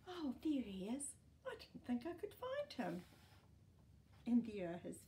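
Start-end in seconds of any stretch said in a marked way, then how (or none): 0.1-3.0 delighted surprise. Real, not acted. Finds boy in picture.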